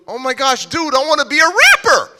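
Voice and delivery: high, loud thrilled exclamation; excited outburst. Retold or imitated. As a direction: reenact blurting out a brand-new dream. Retells his thrilled outburst to friend.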